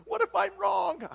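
high, loud anxious wail; exaggerated high-pitched fretting; mock panic. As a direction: squeal it like a hammed-up worrier. Mimics fear of being wrong.

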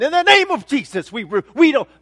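hammed-up, loud Southern rebuke; Southern accent. Preacher imitates believers praying against it, loud Southern accent. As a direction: cry it out in a mock Southern accent. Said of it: "sorry for the southern accent".

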